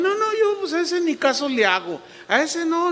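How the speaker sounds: parodia aguda de creyente que no hace caso; cristiano inocente. Acted. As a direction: imita con voz muy alta al ingenuo confiado. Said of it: "O también". (cristiano despreocupado, imitado en tono agudo)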